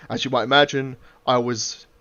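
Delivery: ordinary delivery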